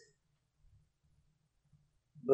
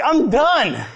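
whiny defeat; 10 semitones higher, 6 dB louder than usual. Retold or imitated. Collapsed on a rock, quitting.

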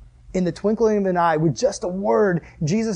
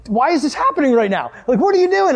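high, pleading incredulous questions; hammed-up bafflement, rising to a loud cry; exasperated disbelief; 8 semitones higher, 4 dB louder than usual. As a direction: demand an explanation, voice climbing. Retold exasperation at dad in storm.

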